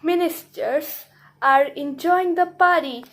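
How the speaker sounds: ordinary delivery, nothing marked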